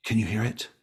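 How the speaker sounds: usual speaking voice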